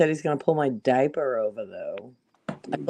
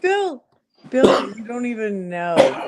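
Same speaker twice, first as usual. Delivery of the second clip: excited; 6 semitones higher, 3 dB louder, slower than usual. Real, not acted. Urgent outcry to Bill.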